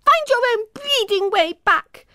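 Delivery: high, loud angry yell. A narrator's reading. Emma yelling back at Poppy over her shoulder, high and loud. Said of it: "Emma yelled".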